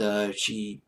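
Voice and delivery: ordinary delivery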